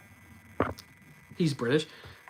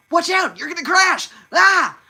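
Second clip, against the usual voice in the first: high, loud panicked cartoon warning; screamed warning; 13 semitones higher, 8 dB louder than usual. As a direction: shout a frantic warning that ends in a scream. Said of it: "Except for when he says".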